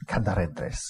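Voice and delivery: "Cadaredres" run together as one word; Welsh name demo. Shown as a demonstration. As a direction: glide through "Cadaredres" as "kadar-EDress". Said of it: "it actually is pronounced".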